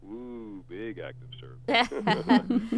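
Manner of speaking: slow, drawn-out mock awe. Counselor joking about diapers to hosts, in mock awe. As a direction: stretch it out like it's a huge deal.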